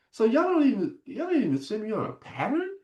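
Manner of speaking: high-pitched incredulous protest; retold indignant question. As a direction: demand an answer in mock disbelief.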